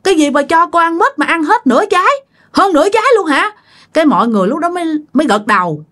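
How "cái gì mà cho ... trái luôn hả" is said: high-pitched mock scolding of a great-grandmother; retold scolding. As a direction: exclaim in shocked reproach, pitch raised. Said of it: "bà cố mới la nói".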